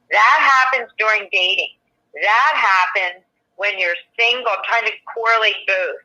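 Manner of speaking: usual speaking voice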